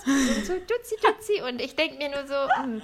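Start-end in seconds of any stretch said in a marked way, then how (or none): 0.5-1.4 high, cooing "Duzi, Duzi"; baby talk. Retold or imitated. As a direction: coo like talking to a baby. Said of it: "in Babysprache sprechen".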